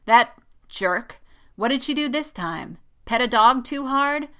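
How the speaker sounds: teasing. A narrator's reading. Poe needles Jessica about her complaint, teasing. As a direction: tease a friend with mock questions.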